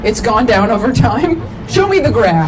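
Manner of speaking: shouted across the room to the couple; loud call-out. Real, not acted. Crowd work shouting loudly.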